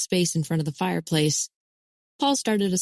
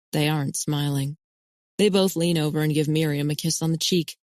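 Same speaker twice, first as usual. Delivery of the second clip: plain reading voice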